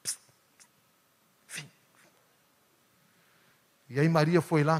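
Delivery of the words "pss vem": barely voiced psst to call her sister; hushed psst. Martha secretly calling Maria over, whispering. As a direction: hiss a secret summons. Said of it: "Tipo assim".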